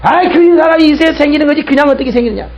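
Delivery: high-pitched exasperated rebuke; acted angry outburst in a rush of words; angry scolding. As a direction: argue back as if fed up. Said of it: "화가 나가지고 막 욕을 합니다".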